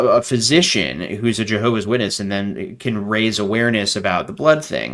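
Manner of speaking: usual speaking voice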